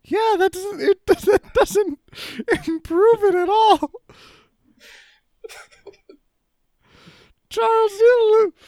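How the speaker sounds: squeaky high character voice; high put-on voice. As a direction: pitch it way up and whiny. Same held high voice.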